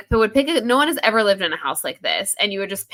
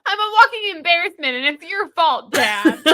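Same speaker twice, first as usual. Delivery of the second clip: higher, louder mock daughter blaming dad; 8 semitones higher, 4 dB louder than usual. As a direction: whine it like a dramatic kid.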